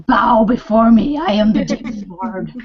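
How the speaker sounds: laughing mock demon lord proclamation; hammed-up villain decree, half laughing; megalomaniac rant. Playing Sesshomaru, villain persona.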